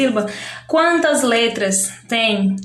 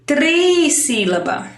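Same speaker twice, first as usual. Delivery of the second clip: "trissílaba" with a long, raised "triii"; teacherly drawn-out "trissílaba"; emphasized term; 3 semitones higher, slower than usual. Shown as a demonstration. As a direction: stretch the first syllable of "trissílaba". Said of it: "então são três sílabas".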